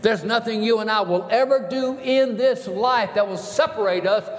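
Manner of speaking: climbing, emphatic pulpit declaration; impassioned preaching. Acted. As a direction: proclaim it to the whole church. Preaches at full force.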